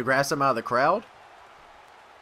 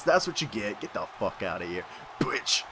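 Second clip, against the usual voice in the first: ordinary talk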